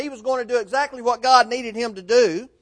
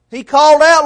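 crying out; 7 semitones higher, 11 dB louder than usual. Retold or imitated. Preacher voicing Peter sinking, loud high cry for help. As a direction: cry out for rescue as you sink.

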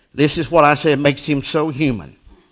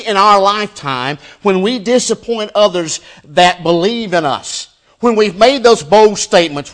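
loud, higher, emphatic preaching; full-force preaching; 7 semitones higher, 10 dB louder than usual. Real, not acted. Preacher warning a congregation about broken bold statements, full-force preaching. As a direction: drive the lesson home at raised volume.

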